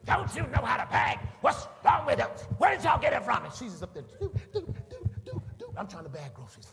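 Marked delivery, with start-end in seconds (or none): 0.0-3.4 high, very loud irate shout; hollered scolding, pitched way up; yelling customer. Retold or imitated. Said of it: "the customer is yelling at me". 4.0-5.7 high, steady checkout beep; high-pitched electronic blips by mouth; imitated scanner beeps. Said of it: "those new beeper checkouts".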